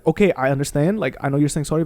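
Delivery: usual speaking voice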